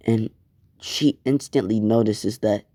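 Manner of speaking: plain reading voice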